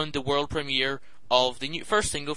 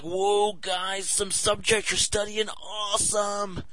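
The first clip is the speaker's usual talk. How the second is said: sarcastic mimicry; 5 semitones higher, slower than usual. Mocking happy people.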